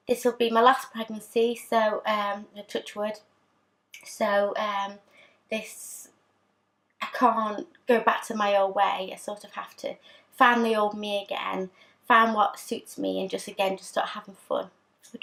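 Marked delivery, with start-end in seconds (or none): none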